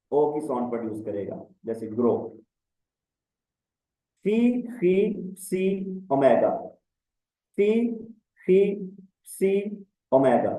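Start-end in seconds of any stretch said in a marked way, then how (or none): 4.2-5.3 फी, फी stretched very slowly, each syllable held; teacher drilling फी, फी slowly for students to copy; slow drawn-out drill. Shown as a demonstration. Teacher drilling students, very slow फी, फी. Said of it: "teacher drills Greek letters slowly". 5.3-5.6 slow drill. Shown as a demonstration. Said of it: "teacher drills Greek letters slowly".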